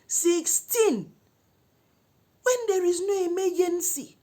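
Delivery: very high, loud disbelief; outraged exclamation. Retold or imitated. Storyteller finding sixteen missed calls, outraged. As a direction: cry out in indignation.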